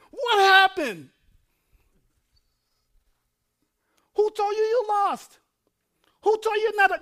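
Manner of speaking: high, loud triumphant shout; full-force preaching. Real, not acted. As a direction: preach it as a victory cry.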